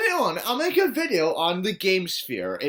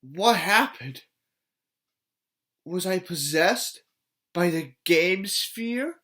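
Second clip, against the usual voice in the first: slow, puzzled, wondering; mock dazed; 3 semitones higher, slower than usual. Vlogger pretending to come out of a trance. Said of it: "mock dazed afterward".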